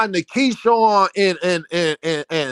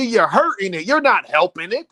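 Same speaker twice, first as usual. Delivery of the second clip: ordinary talk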